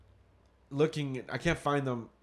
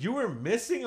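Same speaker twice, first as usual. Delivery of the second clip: imitating mom, a high startled question; mom's surprise, pitched way up; surprised question; 10 semitones higher than usual. Mom learning her son was lost, high surprised question.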